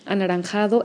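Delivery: ordinary talk, nothing marked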